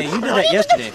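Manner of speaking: ordinary talk, nothing marked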